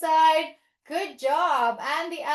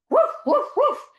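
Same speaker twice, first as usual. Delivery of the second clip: high, loud yappy woofs; dog bark; 10 semitones higher, 5 dB louder than usual. Pretend. Teacher being Lola the dog, high yapping barks. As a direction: bark like an excited little dog.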